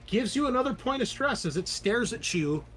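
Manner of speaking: ordinary talk, nothing marked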